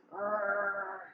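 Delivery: low, soft growl; drawn-out rumbling "grrr"; dog growl. A narrator's reading. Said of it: "The dog still says".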